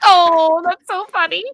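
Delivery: high, loud, gushing oh that's so funny; mock delight. Reacting to the book's joke with mock delight. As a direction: ham up a bright fake reaction. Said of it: "And I was like".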